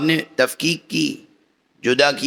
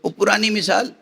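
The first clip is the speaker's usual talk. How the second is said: ordinary delivery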